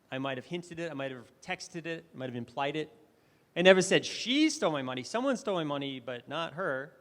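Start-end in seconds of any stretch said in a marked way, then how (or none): 3.5-6.9 she punched louder; single out she; stress on she. Shown as a demonstration. Speaker demoing stress to the audience, stress on she. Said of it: "I emphasized different words".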